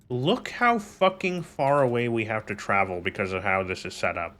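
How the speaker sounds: talking while chewing a pen. Real, not acted. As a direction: mouth busy chewing a pen. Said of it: "Sorry, I'm chewing on a pen".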